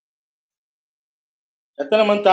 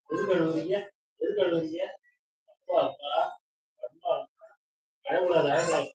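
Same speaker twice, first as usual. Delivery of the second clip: soft soothing; 10 dB quieter than usual. Real, not acted. Grandparent softly hushing a crying grandchild.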